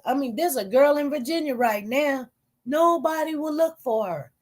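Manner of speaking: usual speaking voice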